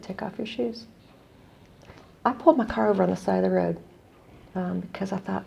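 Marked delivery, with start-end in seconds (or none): 0.0-0.9 soft, retold instruction; gentle request. Social worker kneeling before a limping boy, softly gentle. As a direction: coax softly, as if knelt before a child.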